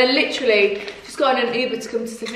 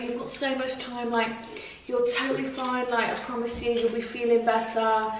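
gentle reassurance; 8 dB quieter than usual. Retold or imitated. Voicing kind Uber driver.

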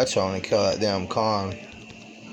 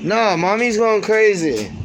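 loud; 9 semitones higher, 5 dB louder than usual. Real, not acted. Comment during argument.

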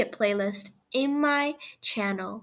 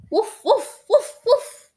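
excited puppy barks; 7 semitones higher, 5 dB louder than usual. Acted. Excited puppy barking, laughing.

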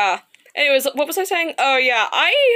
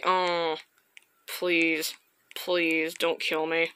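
quiet pleading; 6 semitones lower, 7 dB quieter, slower than usual. Real, not acted. Pleading to the game monster.

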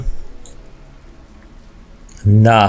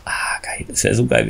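excited whisper; 5 semitones lower, 6 dB quieter than usual. Real, not acted. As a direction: breathe it out in awe. Excited whisper about a sequel.

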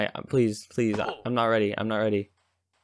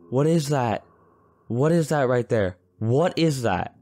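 alarmed questioning; 5 semitones higher, 4 dB louder than usual. Real, not acted. Spots strange creature.

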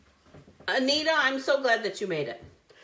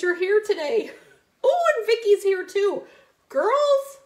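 excited greeting; 9 semitones higher, 5 dB louder than usual. Real, not acted. Host cheering as another friend joins the stream. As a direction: call out with delight as friends arrive.

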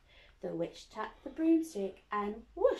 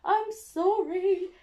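higher, louder, slow frightened apology; spluttering dragon; 9 semitones higher, 10 dB louder, slower than usual. Acted. Dragon backing off from the beast, frightened. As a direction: splutter it nervously. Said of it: "he spluttered".

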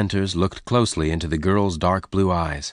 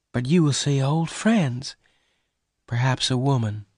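mild sadness; 6 semitones higher than usual. Acted. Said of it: "Bess said sadly".

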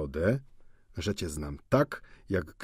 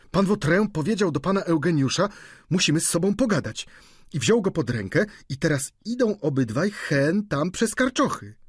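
a lightly voiced cook, higher and fast-paced; excited servant; 9 semitones higher, 4 dB louder than usual. A narrator's reading. Fat cook Sylwia reporting the duel, higher and excited. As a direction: gabble the news like a flustered cook.

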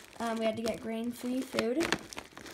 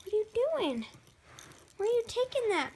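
high-pitched, sing-song questions to a pet; pet talk; 7 semitones higher, faster than usual. Real, not acted. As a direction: ask a mischievous ferret what it's up to. Questioning the wandering ferret.

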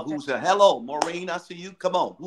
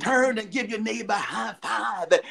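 shouted, raised-pitch pulpit exhortation; preacher voice; 4 semitones higher than usual. Shown as a demonstration. Host imitating the bishop for the panel, shouted preacher voice. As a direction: rouse the congregation like a bishop. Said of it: "Give me an example of the preacher voice".

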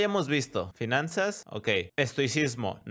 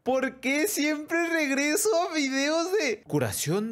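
high, slow wail of despair; long exasperated whine; exasperated lament; 11 semitones higher, slower than usual. Real, not acted. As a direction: drag it out high, utterly fed up. Lamenting the feed's return to junk.

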